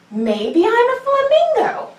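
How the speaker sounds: high, slow wondering voice; high slow hopeful musing; dreamy flamingo wish. Acted. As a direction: muse it up high like a hopeful dodo. Character thought in kids yoga story.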